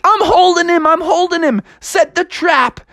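high, straining action voice; high-pitched mom in battle; Froggy's mom. Acted. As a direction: call out urgently while holding on. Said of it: "she was like".

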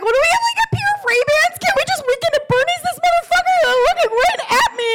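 very high, frantic squeal of panic; panicked squeal. Retold or imitated. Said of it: "And then I'm like".

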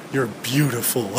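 ordinary talk, nothing marked

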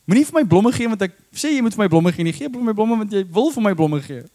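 high, fast voice of an exasperated wife; nagging wife. Retold or imitated. Wife mimicked complaining to her husband, high and fast. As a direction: play the wife scolding her husband in Afrikaans. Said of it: "many women have this problem with their husbands".

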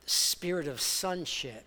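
normal talking voice

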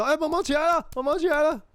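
father at school begging his son to wake, very high; pleading dad. Retold or imitated. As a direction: plead with a limp kid to get up. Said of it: "那我表演他今天早上发生的事".

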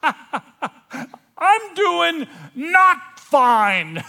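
very high, slow, full-volume shout; yelling out. Acted. Confessing to the whole room, yelling out. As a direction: scream it out word by word. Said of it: "I yell out".

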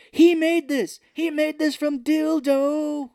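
high, loud squealing impression; mock shriek. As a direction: shriek it out like a cartoon villain. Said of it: "he's like".

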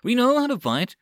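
an aide yelling hotly in his troop's defence. A narrator's reading. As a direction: shout back, stung and angry. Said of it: "yelled out hotly".

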